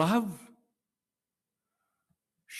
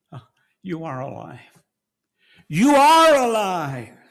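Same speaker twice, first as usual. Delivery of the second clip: soaring louder cry of joy, slowing; overjoyed cry; 5 semitones higher, 10 dB louder than usual. Acted. Mary recognising Jesus in the garden, crying out with joy. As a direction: burst out in amazed joy.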